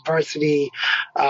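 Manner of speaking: ordinary talk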